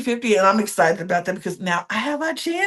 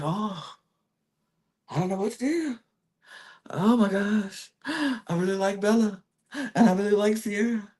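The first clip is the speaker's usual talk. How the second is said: soft, hesitant imitation of indecision; mock agonizing; 8 dB quieter than usual. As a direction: quietly act out being torn. Voicing torn man agonizing between two.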